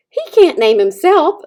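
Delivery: Loudwing scolding the robot; squawking goose. A narrator's reading. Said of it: "squawked Loudwing".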